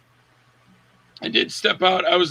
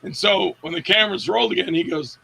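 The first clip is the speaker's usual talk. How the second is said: usual speaking voice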